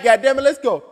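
normal talking voice

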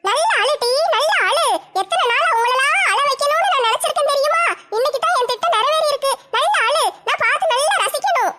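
wicked neighbour mocking a crying woman; gloating gossip. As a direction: relish her crying, laughing. Gossip neighbour gloats over fight.

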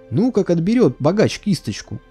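ordinary delivery, nothing marked

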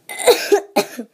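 a voiceless, airy cough; breathy cough. Acted. Sick girl coughing as her friend leaves, breathy cough.

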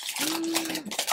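high, soft gasp of surprise; sharp intake of breath; amazed gasp. Real, not acted. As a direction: gasp in real delight. Amazed at the gift.

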